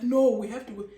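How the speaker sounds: loud panicked insistence from the husband; panicked urging. Retold or imitated. Husband panicking at wife to leave for hospital, loud urgent. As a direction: urge her out the door in a panic. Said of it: "I've never seen him panic like this".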